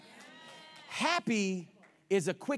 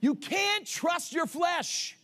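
slow preaching shout; 7 semitones higher, much slower than usual. Real, not acted. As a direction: drag each word out and hit it hard. Preacher emphatic warning.

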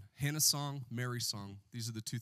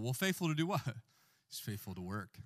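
ordinary talk

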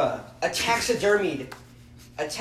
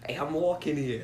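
softer mock New York accent; a quick, understated New York impression; 3 semitones lower, 6 dB quieter than usual. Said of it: "Oh, that's New York".

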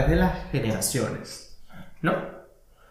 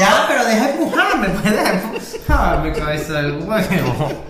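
laughing protest; 4 semitones higher than usual. Real, not acted. Being pushed, laughing.

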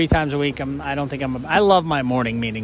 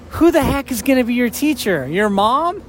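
incredulous dad; 8 semitones higher than usual. Retold or imitated. Father doubting son's homeschool plan, higher incredulous scoff. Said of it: "My second thought was".

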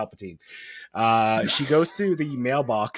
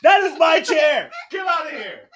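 mock outraged shout; 13 semitones higher, 4 dB louder than usual. Real, not acted. Child took the host's chair.

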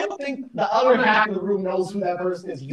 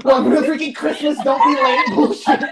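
raised voice breaking into laughter; laughing rant; 4 semitones higher than usual. Real, not acted. Host jokes about Chipmunks Christmas songs, laughing. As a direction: crack up while talking.

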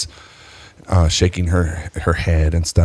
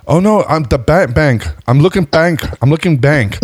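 high, flustered stammer; stuttering breakdown; 8 semitones higher than usual. Retold or imitated. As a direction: trip over the words, dropping some. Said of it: "I started stuttering".

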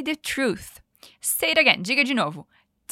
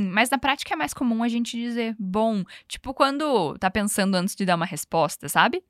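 normal talking voice

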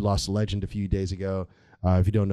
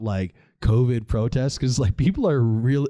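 ordinary talk, nothing marked

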